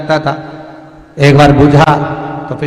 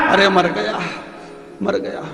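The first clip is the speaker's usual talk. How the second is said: groaning; 2 semitones higher, 7 dB quieter than usual. Acted. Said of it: "अब चिल्लाए".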